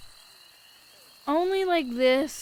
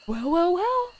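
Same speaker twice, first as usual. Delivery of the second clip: higher, smug sing-song gloat; gloating told-you-so; 6 semitones higher, 4 dB louder than usual. Pretend. Outside the doctor's office, gloating at Katie with a higher smug tone. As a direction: drag out the well-well-wells like a smug villain. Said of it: "I'm gonna be like".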